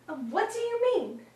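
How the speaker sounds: high-pitched, uneasy question; evasive mother. Acted. Mother dodging her daughter's question, falsely innocent.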